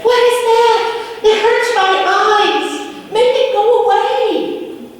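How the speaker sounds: very high, loud panicked voice; frightened cries. A narrator's reading. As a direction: cry out in fear at the glow. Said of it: "it totally freaked out the people".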